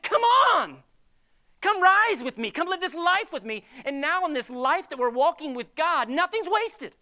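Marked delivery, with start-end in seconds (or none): 0.0-3.7 Jesus calling out. Retold or imitated. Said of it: "He said".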